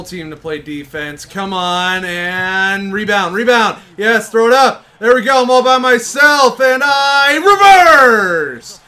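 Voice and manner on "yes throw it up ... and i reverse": loud, high yell of triumph; excited shout. Real, not acted. Gamer scoring a solo layup, shouting excitedly. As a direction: yell it out as you score alone. Scoring alone on a fast break.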